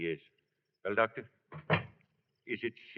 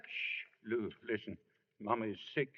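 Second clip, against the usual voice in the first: whispered shh, then soft and low; hushed warning; 3 semitones lower, 8 dB quieter than usual. Acted. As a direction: keep it down, someone is sick in the next room. Father hushing near sick wife.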